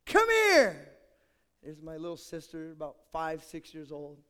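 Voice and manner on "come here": high, loud, slow sing-song call up the stairs. Retold or imitated. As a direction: call little sister down sweetly. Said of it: "So I would say".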